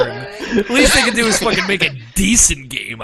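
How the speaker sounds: loud, laughing mock-scornful imitation. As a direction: sneer the line back with a laugh. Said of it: "You said that with such fucking scorn".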